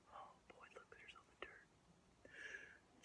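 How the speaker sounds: hushed whisper. Acted. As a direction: whisper it to the kids. Whispered aside.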